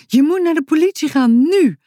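put-on excited child shouting an order; urgent demand. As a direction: urge him on right now. Boutje confronts the thief.

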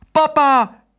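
put-on very high child's cry; high, excited squeal of a word; calling out. A narrator's reading. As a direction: call out for Papa eagerly. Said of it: "riep Otje".

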